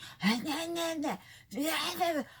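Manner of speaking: high-pitched wordless mimicry of yapping; mocking nyah-nyah babble. Vlogger mocking friend's TV chatter, mocking babble. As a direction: babble nonsense syllables to mock a big talker.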